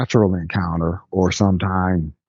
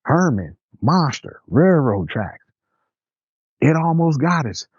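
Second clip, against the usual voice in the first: panicked babbling; 7 semitones higher than usual. Retold or imitated. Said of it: "three people are screaming at you".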